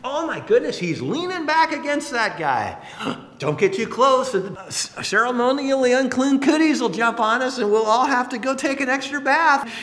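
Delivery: high, aghast gasping onlookers; mock horror at ritual uncleanness, rising in pitch; scandalized Pharisees. Preacher imagining Pharisees looking in on the party, scandalized. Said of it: "picture them looking and going".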